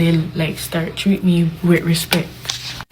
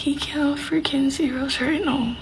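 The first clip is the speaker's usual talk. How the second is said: higher, soft, slow mock-indignant aside; hushed aside imitation; 6 semitones higher, 7 dB quieter than usual. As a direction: retell it as a hushed gripe to your cousin. Indignant aside retold to cousin.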